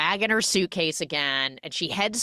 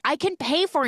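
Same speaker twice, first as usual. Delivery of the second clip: pleading; 12 semitones higher, faster than usual. Retold or imitated. Employee pleading with angry boss.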